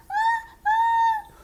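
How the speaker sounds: building sneeze. Acted. Gerald trying to answer Piggy, about to sneeze. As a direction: hold back a sneeze that keeps coming.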